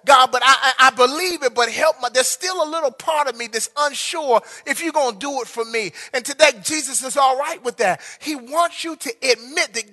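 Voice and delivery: loud, rising sermon delivery over music; full-force preaching. Acted. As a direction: preach it out, urging them to confess their doubt.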